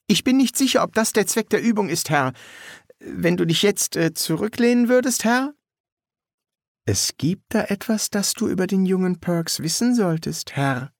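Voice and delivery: put-on high, hesitant young soldier's voice; nervöse Rekrutin Polly. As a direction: raise the pitch, anxiously correcting an officer. Polly redet auf den Leutnant ein. (nervöse, hohe Rekrutenstimme)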